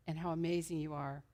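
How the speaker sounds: usual speaking voice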